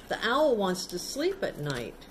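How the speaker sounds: plain reading voice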